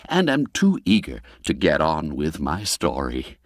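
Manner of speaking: usual speaking voice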